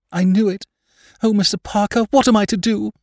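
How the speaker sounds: high-pitched distraught lady; acted feminine despair, voice soaring. A narrator's reading. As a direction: wail it in despair, pitched far up. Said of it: "made a gesture of despair".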